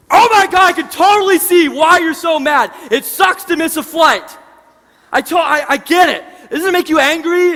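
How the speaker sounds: loud, high, fired-up sympathy that mirrors his fury; matching his anger. Retold or imitated. Clerk mirrors furious traveler's energy, loud sympathetic outrage. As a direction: shout right along with him, on his side. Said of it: "energetically matching his tone".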